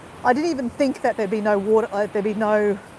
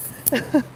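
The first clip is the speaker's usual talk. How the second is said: short laugh; 3 semitones higher than usual. Real, not acted. Laughing at herself on camera.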